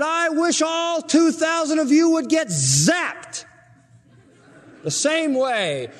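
high, slow hammed-up preacher voice; mock revival preacher. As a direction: parody his zeal. Imitating preacher's wish.

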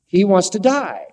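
ordinary talk, nothing marked

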